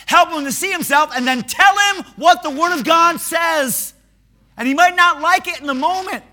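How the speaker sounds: loud high fervent preaching; preaching at full force. Acted. Preacher urging the congregation to confront friends. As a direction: drive the point home from the pulpit.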